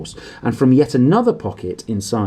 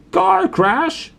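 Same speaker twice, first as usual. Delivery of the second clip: much higher, outraged roar; 4 dB louder than usual. A narrator's reading. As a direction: bellow it in disbelief. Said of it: "roared Hagrid".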